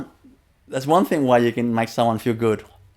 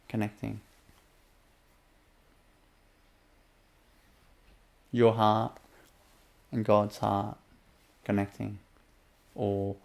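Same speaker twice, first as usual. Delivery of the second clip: unhurried inner-journey guidance; guided meditation; 4 dB quieter than usual. Acted. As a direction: guide the listener gently inward.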